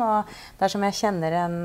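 normal talking voice

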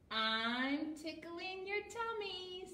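high, drawn-out teasing character; Fluff's voice. A narrator's reading. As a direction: tease slowly, climbing in pitch. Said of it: "said Fluff".

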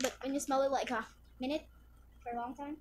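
ordinary talk

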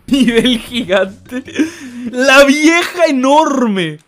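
high, very drawn-out mock announcement; hammed-up bellow. Streamer hails the giant woman, hammed-up drawn-out call. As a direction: stretch every word out, loud and high.

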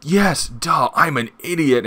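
mock self-scolding idiot voice; mock dopey self-scolding. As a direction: play him as a dope who just caught on. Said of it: "And he's like".

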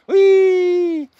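loud high whee of delight; gleeful whoop. Real, not acted. Man delighting in the sky, loud gleeful whoop. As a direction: let out a joyful whee.

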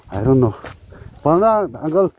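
ordinary talk

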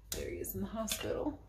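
very soft, near-whispered remark; hushed aside. Real, not acted. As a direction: point it out quietly to the listener. Quiet aside showing the listener something.

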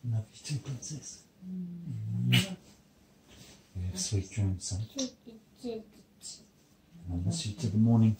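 very soft, loving hush; real quiet bedtime tenderness; tender goodnight. Real, not acted. Saying goodnight to own children.

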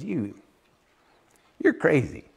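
calm quiet put-down; unbothered dismissal. Pretend. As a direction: shrug him off quietly from heaven. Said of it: "God just sits in heaven and goes".

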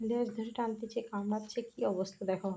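soft, quick hushed remark; hushed commentary. Real, not acted. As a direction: murmur about the pet. (চাপা গলায় মন্তব্য)

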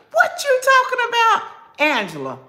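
high-pitched, loud comic squawk; mock indignation. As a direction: squeal it out in disbelief at a viewer. Mock indignant reply to viewer.

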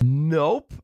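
a smug, self-satisfied nope. Retold or imitated. Oil seller shutting down the almond lady, smug. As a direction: toss it off, pleased with yourself. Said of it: "I said smugly".

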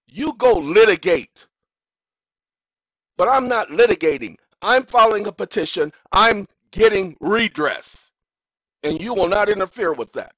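loud, high, furious outburst; real anger. Real, not acted. Insisting on his rights to the court, real anger. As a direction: lay down the law. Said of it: "that's letting you know that's real".

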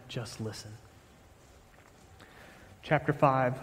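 ordinary talk, nothing marked

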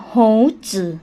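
"โฮ จือ" said as a Mandarin word, syllable by syllable; teacher's model of Chinese "โฮ จือ", two separate syllables; Mandarin pronunciation. Shown as a demonstration. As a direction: sound out "โฮ จือ" slowly in Chinese. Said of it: "คำนี้อ่านออกเสียงว่า".

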